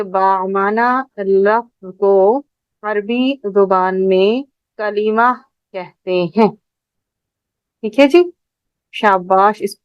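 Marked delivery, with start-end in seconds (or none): 0.0-6.5 measured, drawn-out words with pauses between phrases; slow dictation. Real, not acted. Teacher giving pupils a point to note, slow dictation.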